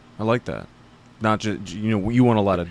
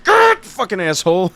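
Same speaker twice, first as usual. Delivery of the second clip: yelled curse at another driver, high and loud; road rage curse; 10 semitones higher, 8 dB louder than usual. Retold or imitated. Driver in traffic cursing another car, angry yell. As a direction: snap at the guy who cut you off. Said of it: "and I'm like".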